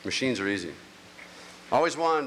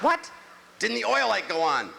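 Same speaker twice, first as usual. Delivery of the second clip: much higher, strained; high disbelieving voice; 9 semitones higher than usual. Retold or imitated. Husband hearing his wife's answer, squeaking in disbelief. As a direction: squeak with shock. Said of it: "weird little octave".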